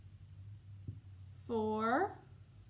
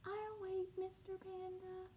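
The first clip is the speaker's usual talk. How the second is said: much softer, small patient penguin voice; very quiet; 9 semitones higher, 12 dB quieter than usual. Acted. Little penguin quietly answering Mister Panda. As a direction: keep it soft and gentle. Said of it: "said it very quiet like this".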